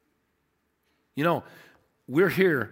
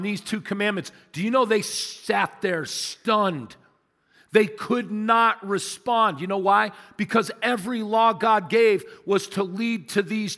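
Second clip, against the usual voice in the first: forceful, measured preaching with weighted key words; emphatic preaching; 3 semitones higher, 4 dB louder than usual. Real, not acted. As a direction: preach it out with conviction, pausing between phrases. Preacher drives home the commandment.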